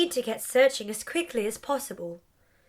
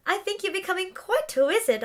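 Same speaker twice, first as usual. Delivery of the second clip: laughing; 8 semitones higher than usual. Acted. Laughs heard on line.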